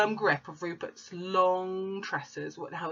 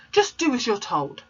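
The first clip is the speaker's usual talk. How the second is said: a little louder and sterner; mild thunder; 6 semitones higher, 6 dB louder than usual. Acted. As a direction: order her about.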